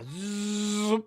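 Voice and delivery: long, steady buzzing zzz; imitated clipper buzz. Man telling how he shaved his man bun, buzzing like clippers. Mimics clippers shaving the hair.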